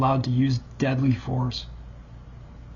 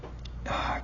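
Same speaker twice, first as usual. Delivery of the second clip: winded breath out; breathless whispered exhale; 11 dB quieter than usual. Real, not acted. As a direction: push out a tired breath. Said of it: "I'm really out of breath".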